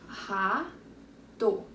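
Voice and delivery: "Rato" split as ra, to with a pause; slow two-beat "Rato" for counting; syllable by syllable. Shown as a demonstration. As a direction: say each half of "Rato" separately. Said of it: "Duas sílabas".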